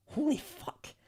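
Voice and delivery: high, soft disbelieving exclamation; hushed astonishment. Real, not acted. Streamer reacting to the listing's rank, hushed astonishment. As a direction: let it slip out softly, stunned.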